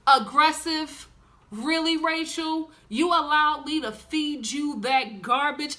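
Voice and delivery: incredulous. Real, not acted. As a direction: stretch it out, can't believe it. Incredulous at Rachel's storyline.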